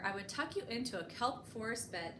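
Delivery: plain reading voice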